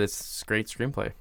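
ordinary talk

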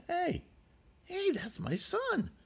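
hushed high call of sudden recognition; startled father. Acted. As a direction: gasp it out high and soft, like an old man seeing his lost son. Father spots his long-lost son.

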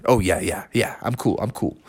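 low, soft mumbled reassurance; sheepish backing down. Acted. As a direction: play it suddenly subdued and low. Bubby backs down when told.

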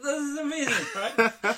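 high, loud laughing squeal; laughing delight. Retold or imitated. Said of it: "I was like".

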